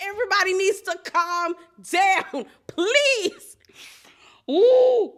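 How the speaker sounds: squealing at the chaos; high laughing plea. Real, not acted. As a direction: beg them to calm down, giggling. Host pleading, laughing.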